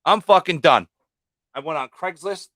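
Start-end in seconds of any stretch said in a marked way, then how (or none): 0.0-0.9 fed-up outburst. Retold or imitated. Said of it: "And I'm like".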